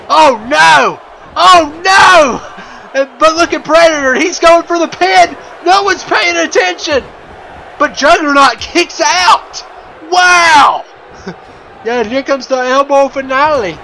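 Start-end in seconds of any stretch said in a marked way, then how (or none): 0.1-10.9 high, loud, breathless commentary; excited shout. Real, not acted. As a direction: yell over the chaos, half laughing. Chaotic finish, shouting with laughter.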